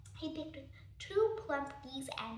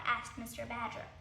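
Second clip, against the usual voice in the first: usual speaking voice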